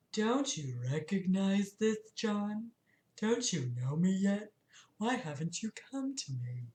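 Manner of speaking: very soft, soothing male voice. Acted. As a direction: soothe him gently, almost under your breath. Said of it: "A soft, soothing male voice".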